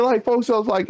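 usual speaking voice

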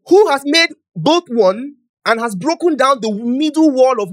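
slow, loud, high scripture reading; loud slow proclamation. Real, not acted. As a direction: ring out the verse.

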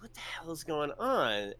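hushed whispered disbelief. Retold or imitated. Hurrying down the stairs, whispering to himself. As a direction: breathe it out under your breath. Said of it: "I'm like".

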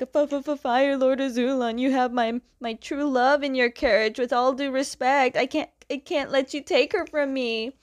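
hammed-up timid voice; scared stammer. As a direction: act out a frightened challenger, stuttering at first. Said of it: "he's kinda scared of what he's doing".

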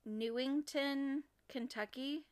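"Newington, Kentucky" drawn out and rising, as if asking; question-mark tune. Real, not acted. Host unsure of own notes, "Newington, Kentucky" with a questioning rise. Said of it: "with a question mark".

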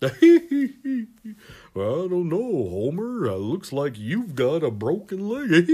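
chuckling cartoon doctor, singsong delivery; Doctor Hibbert. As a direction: bookend the line with a hammy giggle. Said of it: "sound like fucking Doctor Hibbert".

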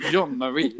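put-on lilting French accent. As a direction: say the name like a French speaker. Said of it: "We would always say it in a French accent".

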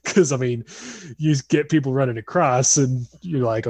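usual speaking voice

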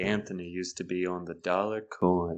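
low, soft late-night purr; sultry voice. Pretend. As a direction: seductive coin-talk murmur. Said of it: "but in a sultry voice".

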